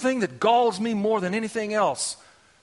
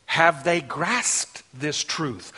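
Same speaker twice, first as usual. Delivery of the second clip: normal talking voice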